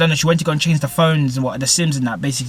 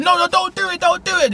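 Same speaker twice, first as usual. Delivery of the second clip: much higher, pleading "don't do it"; frantic high-pitched begging; desperate plea. Retold or imitated. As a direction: beg them to stop in a high squeal. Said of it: "she was like".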